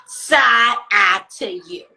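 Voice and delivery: slow side eye. Shown as a demonstration. To messy roommates, a slow loud side eye. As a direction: stretch every word with contempt.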